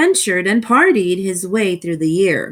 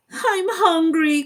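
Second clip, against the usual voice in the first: mild whiny cry; 7 semitones higher than usual. Acted. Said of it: "cried Cyril".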